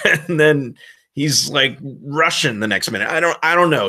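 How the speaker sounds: ordinary talk, nothing marked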